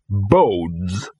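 one drawn-out, portentous word; ominous. A narrator's reading. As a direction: let "Bodes" hang ominously in character. Dog delivers an ominous verdict.

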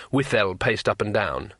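ordinary delivery, nothing marked

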